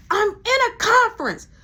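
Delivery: annoyed manager behind a closed door; irritated boss. Retold or imitated. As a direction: shut them out of the office.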